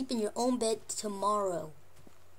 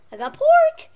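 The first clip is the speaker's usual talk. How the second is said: high, much louder pet-coaxing squeal; excited coaxing squeal; 15 dB louder than usual. Real, not acted. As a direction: squeal "pork" to lure the dog.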